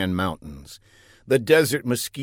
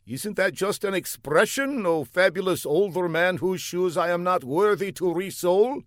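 earnest, high put-on foreign voice; an eager young spy calling out; high comic foreigner; 7 semitones higher than usual. A narrator's reading. Fla calls down the block to the older man, high and eager.

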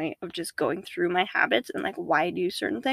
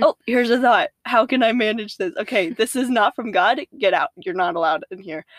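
louder, bossy checkpoint guard voice; mock checkpoint guard; 3 semitones higher, 5 dB louder than usual. Picturing a TSA checkpoint for thoughts, loud bossy guard. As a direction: play a strict guard turning a thought away. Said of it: "a T S A checkpoint in your head of being like".

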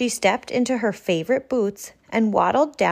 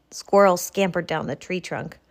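ordinary delivery

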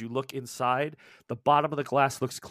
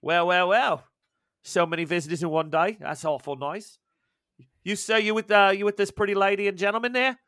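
lightly higher Australian barmaid voice; sizing up visitors in a mild Aussie lilt; 5 semitones higher, 4 dB louder than usual. Acted. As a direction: barmaid greeting the newcomers in light Australian accent. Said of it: "She's like".

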